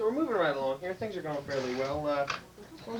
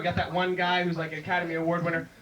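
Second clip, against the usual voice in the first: ordinary talk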